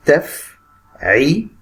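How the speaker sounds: متفعل said very slowly, short-long alternating; drawn-out syllables. Shown as a demonstration. Teacher slowly sounding out متفعل for students. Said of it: "قصير طويل، قصير طويل".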